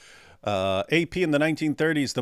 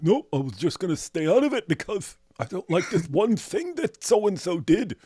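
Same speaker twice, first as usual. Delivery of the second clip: mocking A P; 5 semitones higher than usual. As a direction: tease the friend's excuse in a lightly altered voice. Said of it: "But A P be like".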